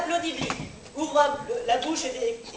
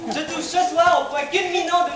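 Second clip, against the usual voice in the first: excited; 3 semitones higher, 5 dB louder than usual. Acted. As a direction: gush with amazement. Reporter thrilled after the performance.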